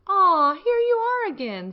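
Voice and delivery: friendly ladybug welcoming back the grouchy one, high and sweet. Acted. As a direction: greet a lost friend home with delight. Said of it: "said the friendly ladybug".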